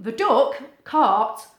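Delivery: plain reading voice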